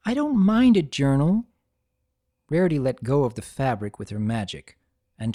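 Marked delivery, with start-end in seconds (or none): 0.1-1.5 put-on higher, sincere young mare; Twilight, earnest and gentle. A narrator's reading. Twilight reassuring Rarity about the gift. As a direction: reassure her kindly.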